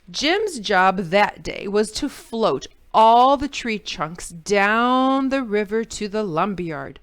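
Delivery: ordinary delivery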